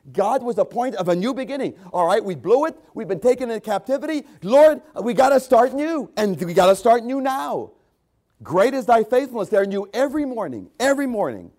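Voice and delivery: loud, high-pitched pulpit preaching, slowing on key words; fiery preaching. Acted. Preacher rousing the congregation to forget the past, loud fiery preaching. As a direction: preach it out with full force.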